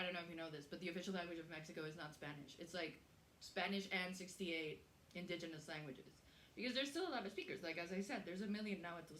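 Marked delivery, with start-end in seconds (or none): none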